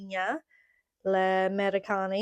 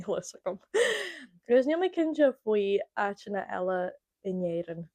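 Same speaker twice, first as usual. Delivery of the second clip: usual speaking voice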